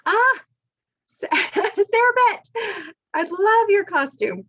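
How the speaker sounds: high, loud squeal of delight with a laugh; delighted greeting. Real, not acted. Host spotting Sarah Beth's costume, delighted.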